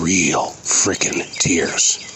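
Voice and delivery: slow, whispered and intense; low whisper stressing real tears; hushed emphasis. Real, not acted. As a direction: drop to a whisper to drive it home. Stressing the man's crying.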